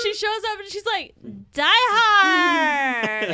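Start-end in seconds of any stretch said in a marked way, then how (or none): 1.5-3.3 put-on loud, slurred drunk party shout; drunk party girl. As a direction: yell it like a tipsy party girl. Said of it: "she sounds like a drunk party girl".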